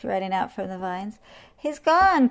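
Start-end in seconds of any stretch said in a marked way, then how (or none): 1.6-2.3 high, loud announcement; excited cry. A narrator's reading. Monkeys swing down from hiding, excited cry. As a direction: cry it out gleefully. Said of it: "cried the monkeys".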